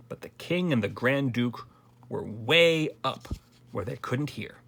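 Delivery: ordinary delivery, nothing marked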